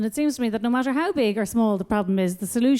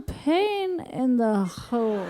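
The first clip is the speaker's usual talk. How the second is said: slow drawn-out phrase; 4 dB quieter, much slower than usual. Retold or imitated. Punchline drawn out slowly.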